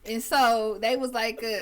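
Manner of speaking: ordinary delivery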